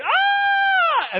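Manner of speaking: very high, loud falling scream. Retold or imitated. Speaker reliving the drop, screaming loud and high. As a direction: let it rip like dropping off a cliff.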